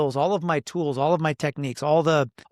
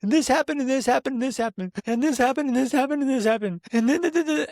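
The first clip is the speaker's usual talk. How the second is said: high, rapid mock venting, pitched way up; mimicking wife's rush; 11 semitones higher than usual. Husband mimics his wife pouring it all out, high mock rush. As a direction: rattle it off like the upset wife. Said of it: "then she starts talking".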